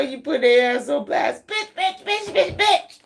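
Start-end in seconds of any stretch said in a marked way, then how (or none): 1.5-3.0 high-pitched mock rant, laughing at the end; hammed-up high tantrum; mad person ranting. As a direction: squeal one curse over and over. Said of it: "they mad as hell".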